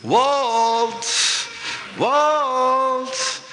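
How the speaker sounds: high, drawn-out singsong call; long high held name, calling from downstairs; wife calling upstairs. Retold or imitated. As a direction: imitate a wife calling up the staircase. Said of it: "her voice drifted up the staircase".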